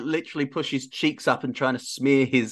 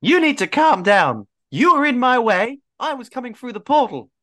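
raised, indignant retort; exasperated in-character protest; arguing back; 12 semitones higher, 4 dB louder than usual. Acted. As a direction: push back hard, higher and louder.

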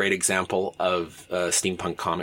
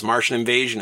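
usual speaking voice

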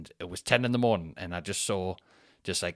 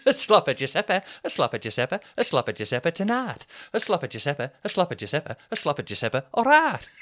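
high, exaggerated Manchester accent; Mancunian accent; 7 semitones higher, 5 dB louder than usual. Shown as a demonstration. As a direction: repeat it gleefully in caricature Mancunian. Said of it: "that Mancunian accent is bang on".

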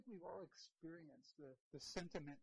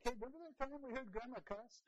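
teasing; 7 semitones higher, 7 dB louder than usual. Retold or imitated. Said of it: "it's like".

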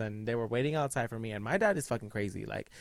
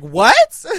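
loud, much higher startled what; shocked; 12 semitones higher, 18 dB louder than usual. Retold or imitated. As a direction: jolt of disbelief. Shocked at dad's threat.

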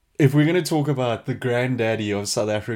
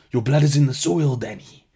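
higher mock South African movie accent; exaggerated South African, starting loud and dropping off; 3 semitones higher, 4 dB louder than usual. Host quoting Blood Diamond, hammed-up South African accent. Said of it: "go watch clips from Blood Diamond".

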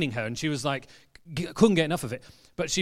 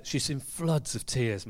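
usual speaking voice

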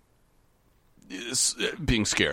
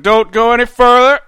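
loud, high yell to halt; shouted warning; 14 semitones higher, 15 dB louder than usual. Retold or imitated. Mom answers the door with a shotgun, shouted warning. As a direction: holler it as a threat from the doorway.

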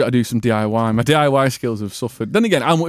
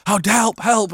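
higher, lightly acted cry for help; mock-serious acting; 6 semitones higher than usual. Pupils in a serious scene, mock distress. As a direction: play at earnest school drama. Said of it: "be like a serious scene like that".

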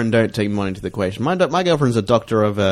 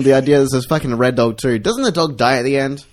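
normal talking voice

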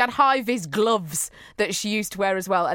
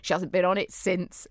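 ordinary talk, nothing marked

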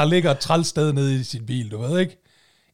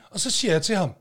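ordinary talk

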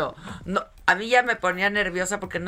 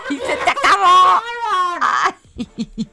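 much higher, loud shout cracking up; laughing shout; 13 semitones higher, 11 dB louder, slower than usual. Real, not acted. Shouting back at her teasing friend, laughing loudly. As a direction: scream it while laughing hard.

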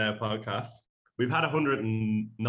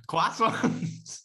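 high-pitched mimicry of a Scottish "Croissants"; mock Scottish accent; 10 semitones higher, 4 dB louder than usual. Podcast host teasing co-host over his pronunciation, mock Scottish accent. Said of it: "how you said croissants".

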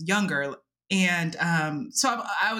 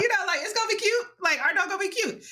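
excited; 11 semitones higher, much faster than usual. Retold or imitated. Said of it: "I'm like".

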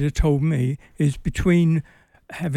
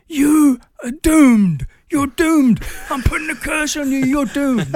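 high, loud, slow dramatic curse; laying a curse; 10 semitones higher, 5 dB louder, slower than usual. Retold or imitated. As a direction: bellow a doom curse like a madman. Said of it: "and shouted at Ali".

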